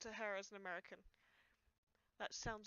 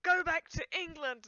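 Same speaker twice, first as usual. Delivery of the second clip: slow, higher mock jeer; imitated heckler; 5 semitones higher, 6 dB louder than usual. Streamer imitating a person from growing up, mock heckling. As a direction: drag it out as a mocking imitation of a bully. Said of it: "this person who was just like".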